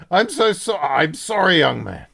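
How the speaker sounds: loud, high, flustered NPC apology; a high, loud, frantic apology. Acted. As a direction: blurt out the sorry in a panic. NPC apologizes after quill stabs hand.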